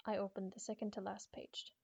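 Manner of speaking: plain reading voice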